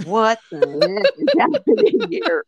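breaking into a laugh of disbelief; shocked laughing what the heck; baffled disbelief. Retold or imitated. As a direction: pitch jumps high, laughing through heck. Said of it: "And I'm like".

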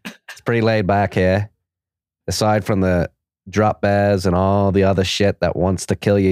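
put-on amateur Aussie accent drifting toward Scottish; Australian accent. Host reading an Aussie listener's shout out, wobbly Australian accent. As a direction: read it as a wobbly, hammed-up Australian. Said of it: "Must read in your best Australian accent".

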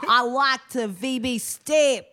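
put-on slow, broad Australian accent; thick Australian accent. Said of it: "the thickest Australian accent".

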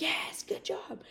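high, breathy whispered cheer; hushed hooray, retold. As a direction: celebrate in an excited whisper. Retold hushed cheer beside the call.

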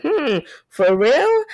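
high, slow doubtful hum and question; sceptical. Acted. Doubting that Aries are powerful, sceptical. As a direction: draw out a dubious hum, rising into the question.

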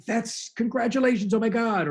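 high-pitched, gushing praise; retold burst of amazed delight; excited congratulations. Retelling delighted congratulations to late sobriety.

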